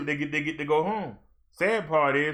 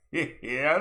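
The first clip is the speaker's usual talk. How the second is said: normal talking voice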